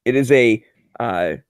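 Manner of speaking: ordinary talk, nothing marked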